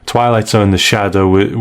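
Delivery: usual speaking voice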